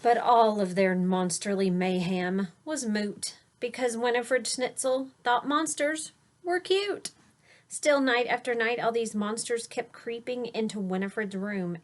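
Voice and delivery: put-on lilting rhyme read to a child; read-aloud storytelling. A narrator's reading. Parent reading a rhyming bedtime book aloud to a child. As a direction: read the verse with a gentle bounce.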